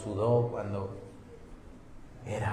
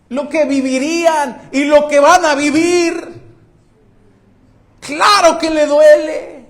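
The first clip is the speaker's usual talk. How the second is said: loud, high-pitched sermon voice; fiery preaching; 13 semitones higher, 13 dB louder than usual. Acted. Pastor preaching loudly to the congregation, fervent. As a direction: preach it out with full force. Preaching at full force again.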